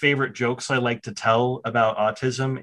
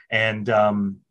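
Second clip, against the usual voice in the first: ordinary delivery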